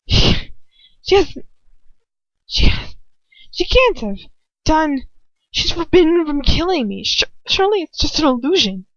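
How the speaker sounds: high, loud, frantic inner panic; rising panic. A narrator's reading. Captive telling herself it's not real, panicking. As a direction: spill out the denial in rising fear.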